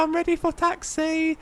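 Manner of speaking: put-on high, slow childlike voice; little kid pilot. Quoting a young pilot asking for taxi, high childlike voice. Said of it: "this wee, like, baby".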